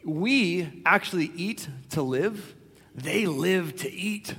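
slow, deliberate movie quote; quoting film raccoon. Retold or imitated. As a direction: draw it out, pausing between the two halves. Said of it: "he says this in the movie".

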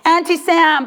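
ordinary delivery

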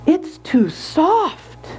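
slow, high-pitched verdict. A narrator's reading. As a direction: draw out each word doubtfully. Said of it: "very slowly said".

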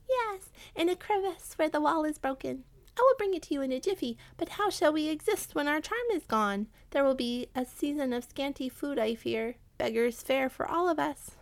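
high rat voice, eager then fretting; worried rat. Acted. Rat agreeing to fetch the trinket, worried. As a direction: promise help, then fret, as a high-pitched rat.

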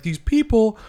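loud, high, emphatic imitation of a crusader; urgent mock warning. Acts out documentary figure's alarmed warning.

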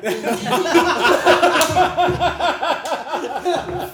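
high, loud burst of laughter; real laugh, loud and high. Real, not acted.

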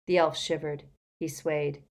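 ordinary delivery

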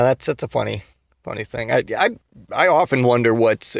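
usual speaking voice

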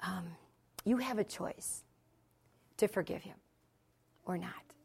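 gentle, measured counsel with soft pauses; tender, quiet firmness; kindly. Retold or imitated. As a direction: imitate kindly advice, softening as it goes. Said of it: "I just kindly said".

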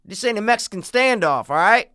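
high, acted standoff challenge; film standoff character. Acts out film standoff dialogue.

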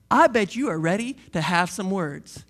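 ordinary delivery

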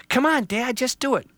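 put-on an eager child pointing out a tree; lightly voiced child. Child tells Dad about the tree, lightly voiced kid.

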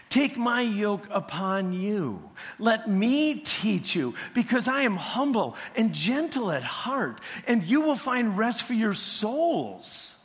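preacher voicing the words of Jesus; scripture reading. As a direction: lift the verse up like a proclamation. Reads scripture with lifted voice.

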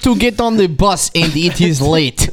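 mock Russian accent. Friend retaking the train woman's complaint, mock Russian accent. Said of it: "His was a little less, a little more racist".

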